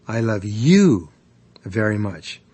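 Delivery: "you" drawn out loud, then a pause; stress on YOU. Shown as a demonstration. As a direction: punch "you", then drop away on "very much". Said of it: "putting the emphasis on any one".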